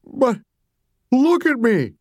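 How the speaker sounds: high, crying plea; a distressed mother begging to be noticed; tearful Mommy. A narrator's reading. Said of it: "Mommy started to cry a little".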